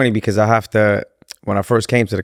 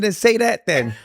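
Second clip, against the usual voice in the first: laughing retort; 12 semitones higher than usual. Retold or imitated. His retold retort, laughing.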